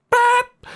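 very high imitated beep; mock beep.

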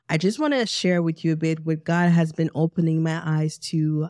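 ordinary delivery

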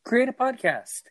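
high, bright-voiced call to create a podcast; eager pitch to listeners; excited urging. Real, not acted. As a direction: pitch up hard like a rallying cry.

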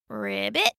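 deep soft ribbit; low frog croak. Acted. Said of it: "said the froggy".

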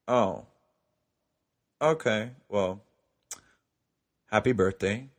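halting, let-down delivery with long pauses; deflated. Retold or imitated. After he forgot his own birthday, deflated second wish. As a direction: drop the excitement, hesitate between words.

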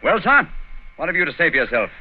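loud, high-pitched demand; stern interrogation. Acted. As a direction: confront the culprit forcefully. Holmes interrogates culprit.

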